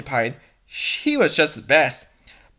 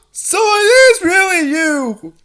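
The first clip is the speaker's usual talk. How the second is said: high, loud and slow, half laughing, half crying; overwhelmed with joy at finding her; tearful laugh in a put-on voice; laughing through tears; 11 semitones higher, 6 dB louder than usual. Overjoyed at finding the princess.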